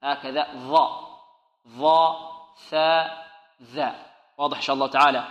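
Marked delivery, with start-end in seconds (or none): 0.6-3.9 ظاء، ثاء، ذا with the tongue tip between the teeth. Shown as a demonstration. Teacher modelling for students, ظاء، ثاء، ذا with protruded tongue tip. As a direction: articulate ظاء، ثاء، ذا singly, clearly interdental. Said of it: "لابد من إبراز طرف اللسان".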